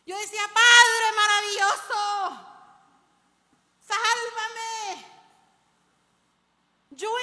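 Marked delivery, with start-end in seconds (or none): 0.5-5.1 re-enacted full-volume cry for rescue, drawn out; desperate shouted prayer. Preacher re-enacts her own prayer during the car chase, desperate shouting. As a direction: bellow it to heaven as if being chased. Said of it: "Pero yo no gritaba así. Yo decía".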